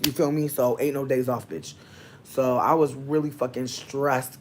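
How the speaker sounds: usual speaking voice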